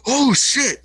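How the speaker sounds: hyped living room exclamation, loud and high; excited outburst. Retold or imitated. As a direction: react to a cool scene at full volume. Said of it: "I'll be like".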